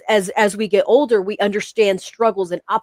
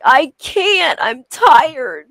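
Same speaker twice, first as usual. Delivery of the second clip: retold tearful protest, pitched up; imitating tearful child; 4 semitones higher, much slower than usual. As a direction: plead like a worn-out kid in tears. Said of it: "started crying".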